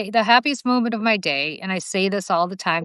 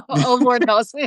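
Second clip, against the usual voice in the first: mock swoon. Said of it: "You think that's how".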